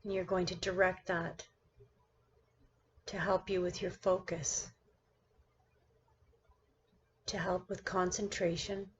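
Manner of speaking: measured meditation-guide delivery with long pauses between phrases; guided meditation. Acted. Teacher guiding her class through seated meditation, guided meditation voice. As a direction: lead the listener into stillness, one phrase at a time.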